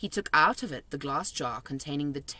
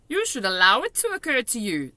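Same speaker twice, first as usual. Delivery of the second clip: higher, louder friendly giant voice; kindly giant, raised voice; BFG giant voice; 7 semitones higher, 7 dB louder than usual. A narrator's reading. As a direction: play the big friendly giant, chatty and odd.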